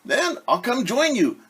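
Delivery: high, eager duck voice; eager Ducky. Acted. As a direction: join in at once. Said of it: "said Ducky Lucky".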